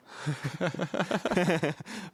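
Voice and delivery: real high-pitched laughing fit; high giggle. Real, not acted. Laughing while telling a friend the prank. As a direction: laugh helplessly at the memory. Laughing at her own story.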